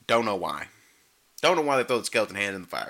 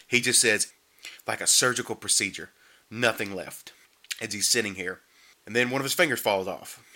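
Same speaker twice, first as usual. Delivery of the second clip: normal talking voice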